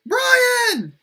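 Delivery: very high, loud squeal of recognition; excited cry. A narrator's reading. William greets Brian with a bro hug, excited shout. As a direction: shout the friend's name in delight.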